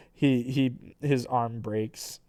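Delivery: usual speaking voice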